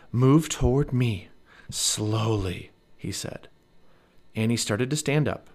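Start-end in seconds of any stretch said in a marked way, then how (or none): 0.1-2.7 slow, measured caution; careful instruction. A narrator's reading. Jack guides Annie away from the dinosaur, slow and careful. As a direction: coax someone gently, word by slow word.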